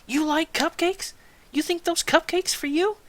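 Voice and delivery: high-pitched mock sergeant interrogation; yelling sergeant. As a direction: imitate a sergeant tearing into a recruit. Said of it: "Gunnery Sergeant yelling".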